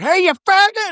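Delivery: high-pitched mock angry yell; Rip Torn. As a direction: imitate an enraged father hollering from a window. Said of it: "Rip Torn just comes flying out the window".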